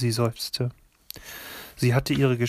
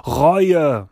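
sudden loud outcry; one word, cried out; like a cry; 3 semitones higher, 9 dB louder than usual. A narrator's reading. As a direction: burst out with it, much louder. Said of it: "wie ein Schrei".

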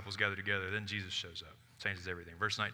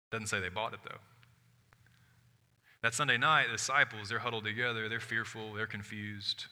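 normal talking voice

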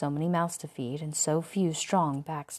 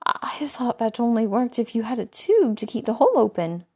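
higher, breathless shocked whisper; 5 semitones higher, 7 dB louder than usual. A narrator's reading. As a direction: gasp it out in horror. Shocked at bloody scene.

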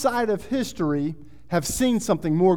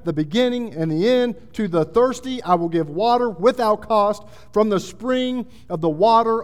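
raised, emphatic preacher's reading; impassioned scripture preaching; 4 semitones higher, 5 dB louder than usual. A narrator's reading. Preacher voices the One on the throne, forceful proclamation. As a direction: declare each promise with weight and force.

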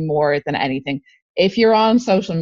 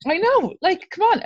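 much higher, exasperated come on; 14 semitones higher than usual. Real, not acted. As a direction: throw up your hands in disbelief. Exasperated at bad accents.

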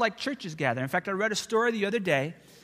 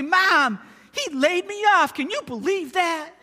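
higher, loud, delighted telling mom; gleeful news; 9 semitones higher, 7 dB louder than usual. Retold or imitated. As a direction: burst out the happy news to mom. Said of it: "I got to go home and say".

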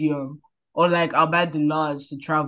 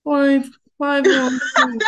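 slow, high robotic announcement; automated airport voice; 8 semitones higher, 5 dB louder, slower than usual. Retold or imitated. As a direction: read it like a machine voice. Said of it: "you know the voice, one automated voice I hear".

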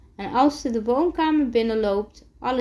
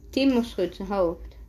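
plain reading voice

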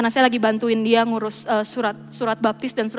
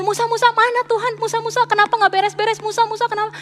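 much higher whiny nagging; mock whine at the weary leader; hammed-up complaining crowd, pitched high; grumbling Israelites; 10 semitones higher than usual. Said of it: "Dikit-dikit ngedumel".

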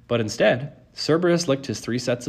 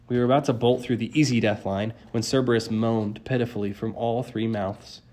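ordinary delivery

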